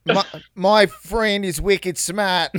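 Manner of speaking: put-on slow, drawn-out Boston accent. Riffing on a Boston movie, slow Boston accent. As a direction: lean hard into a Boston accent. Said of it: "Oh wait, no, I'm doing".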